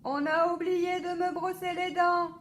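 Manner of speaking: high loud urgent cry; boy yelling to papa about his teeth; child shouting. A narrator's reading. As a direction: shout the news from bed. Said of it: "crie Alphonse".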